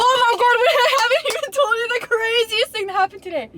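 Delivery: high, fast, bursting with news; excited. Real, not acted. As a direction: rush it out breathlessly thrilled.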